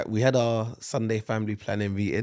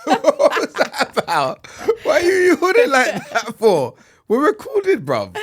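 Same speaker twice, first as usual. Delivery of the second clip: much higher, louder, laughing surprise; laughing disbelief; 10 dB louder, slower than usual. Real, not acted. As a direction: squeal at the weird yawn. Reacting to partner's odd yawn.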